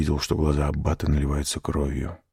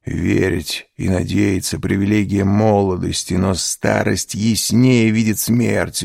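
usual speaking voice

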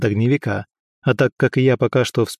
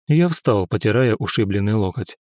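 ordinary delivery, nothing marked

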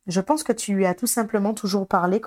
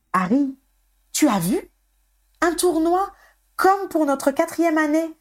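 excited, high-pitched calling out; apostrophe; 9 semitones higher than usual. A narrator's reading. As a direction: accost a friend with big news, climbing much higher. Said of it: "l'apostropha Simus". (interpellation excitée, voix haut perchée)

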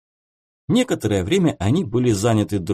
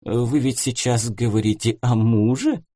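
ordinary delivery

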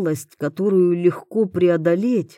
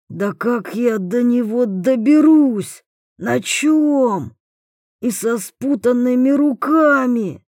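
desperate shouting prisoner; 6 semitones higher, 3 dB louder than usual. A narrator's reading.

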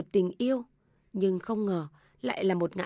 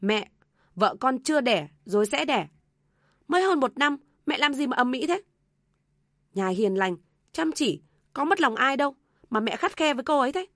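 raised, higher voice defending his wife; indignant pleading; 5 semitones higher, 5 dB louder than usual. A narrator's reading. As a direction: remonstrate heatedly with mother. Son argues back at mother. (con trai cao giọng phân bua bênh vợ)

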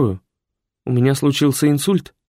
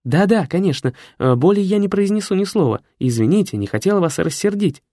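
much higher, hurried apology; flustered secretary; 8 semitones higher than usual. A narrator's reading. Secretary hastily apologises to the hidden voice. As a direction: apologise nervously.

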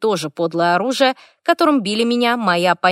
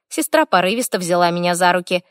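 usual speaking voice

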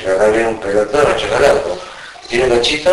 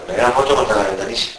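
usual speaking voice

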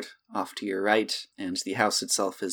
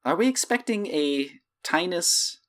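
puzzled servant; 9 semitones higher than usual. Acted. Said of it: "uh, says".

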